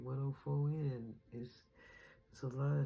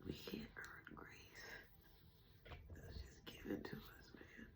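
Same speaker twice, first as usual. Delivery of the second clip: higher, soft, breathless whispering through tears; tearful whisper; 14 semitones higher, 11 dB quieter than usual. Real, not acted. Thanking the divine on camera while crying, whispering. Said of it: "I knew I would be crying".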